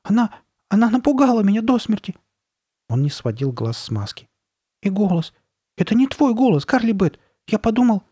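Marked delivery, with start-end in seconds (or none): 0.0-2.2 put-on high, scared little-boy voice; high-pitched shaken complaint; faltering fright. A narrator's reading. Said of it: "пробормотал Ноа". 4.8-8.0 put-on high, alarmed little-boy voice; high-pitched shaken alarm; faltering fright. A narrator's reading. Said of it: "всё ещё запинаясь".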